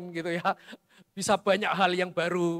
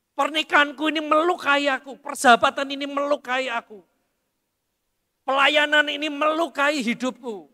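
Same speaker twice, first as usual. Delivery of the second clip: hurt complaint; 6 semitones higher, 5 dB louder than usual. Acted. Preacher acting out hurt complaint.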